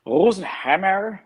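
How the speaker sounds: Rosenhammer drawn out high and slow in a mock Irish German accent. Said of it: "let's hear you say Rosenhammer in your best Irish German accent".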